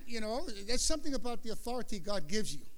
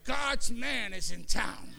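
proud boast; 3 dB louder, slower than usual. Real, not acted. Chest out, boasting.

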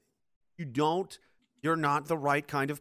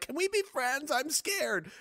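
much higher, small scared plea; mock timid Chamberlain; 11 semitones higher, slower than usual. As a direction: whimper it like a frightened child, mockingly. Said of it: "who's like".

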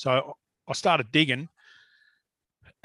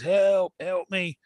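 mock cry for help, only a little raised; retold yell for help; 4 semitones higher, much slower than usual. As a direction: imitate calling out for help, held back. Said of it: "I'm yelling top of my lungs".

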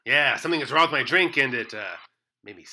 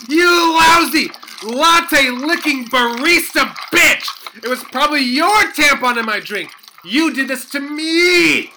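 furious shout; 9 semitones higher, 7 dB louder than usual. Acted. Accusing barista of tampon.

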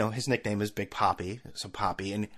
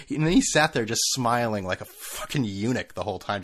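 usual speaking voice

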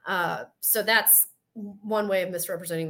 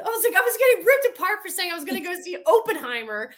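indignant; 10 semitones higher, 5 dB louder than usual. Real, not acted.

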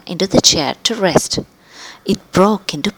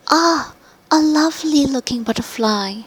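put-on high girlish delight at a butterfly; little girl voice, charmed; 8 semitones higher than usual. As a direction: coo over the butterfly, higher. Goldilocks admires butterfly.

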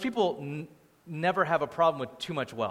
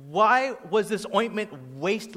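slow, higher-pitched indignation; mock indignant; 5 semitones higher, 4 dB louder, slower than usual. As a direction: act out an outraged onlooker.

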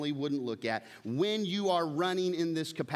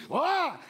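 sudden high yelp, much louder; mock outburst; 13 semitones higher, 6 dB louder than usual. Pastor acting out losing his temper, sharp high yell. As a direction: burst out with a high shout.

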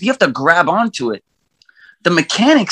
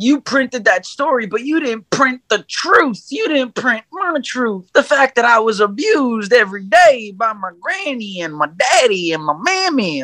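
whiny villain voice; 5 semitones higher than usual. Retold or imitated. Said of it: "he's like".

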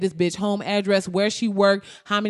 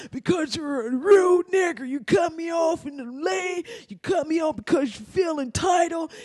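hammed-up rage in a raised pitch; 8 semitones higher than usual. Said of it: "he gets mad".

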